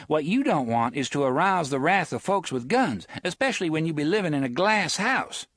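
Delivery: quick, cocky and pointed; sassy warning. Acted. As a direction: warn them with sass. Susanna threatens.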